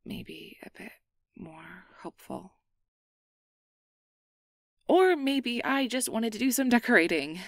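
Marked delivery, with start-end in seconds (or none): 4.9-7.4 louder, higher joking brush-off; playful deflection. Acted. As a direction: laugh off the moment. Brushing off with a joke.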